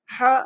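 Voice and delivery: short "ہا" with tight h from middle throat. Shown as a demonstration. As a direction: squeeze "ہا" at mid-throat. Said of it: "یہ درمیان کے حصے سے".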